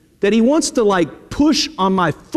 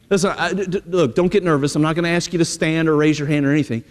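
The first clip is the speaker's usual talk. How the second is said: ordinary talk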